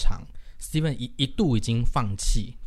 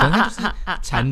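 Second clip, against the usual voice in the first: laughing; 6 semitones higher, 5 dB louder than usual. Real, not acted. Laughing while describing wristbands.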